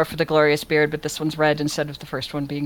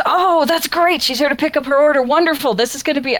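higher, louder, eager shop boss; delighted shop boss; 9 semitones higher, 4 dB louder than usual. Acted. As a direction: gush like a boss thrilled by a big order. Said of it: "And the boss goes".

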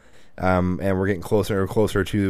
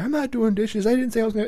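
put-on much higher, indignant kid protest; 12 semitones higher than usual. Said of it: "he's indignant at this point".

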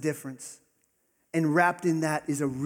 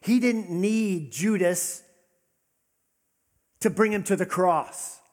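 louder, higher and slow; preaching at full force; 5 semitones higher, 6 dB louder than usual. Real, not acted. Pastor stressing the cross to the congregation, emphatic slow call. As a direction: drive the point home to the room.

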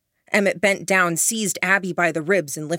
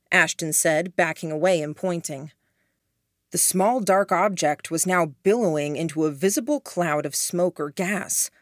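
ordinary delivery

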